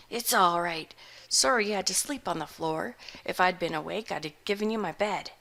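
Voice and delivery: a hungover hermit shrugging it off; gruff old farmer. Acted. Voice of Nathaniel Butterbloom.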